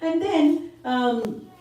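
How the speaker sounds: ordinary delivery, nothing marked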